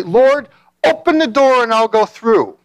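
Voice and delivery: high, drawn-out appeal to the Lord; mock plea to God. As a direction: act out an overeager prayer, stretched out. Acting out people bargaining with God.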